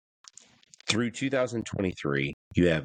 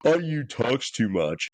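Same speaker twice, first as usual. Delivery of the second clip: slow whiny mimic; mocking rival fans; 4 semitones higher, slower than usual. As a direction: drag it out, mocking. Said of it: "blowhorn in our face twenty-four seven of".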